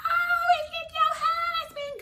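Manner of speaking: squeaky cartoon voice, very high and loud; high-pitched fake-innocent cartoon voice; Mickey Mouse cat voice. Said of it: "comes with this Mickey Mouse cat voice".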